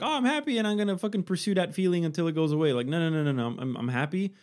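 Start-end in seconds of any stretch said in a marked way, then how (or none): 0.0-2.7 high, fast giddy voice; mock excited self. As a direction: gush like an overeager fool. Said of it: "So happiness is like".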